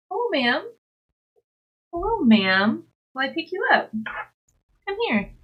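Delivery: high, swooping pet-talk voice. Real, not acted. Streamer greeting the cat to pick her up, high pet talk. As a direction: coo up high to an animal.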